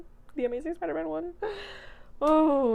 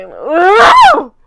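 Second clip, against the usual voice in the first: excited squeal; 9 semitones higher, 22 dB louder than usual. Real, not acted. Drawn out loud squeal.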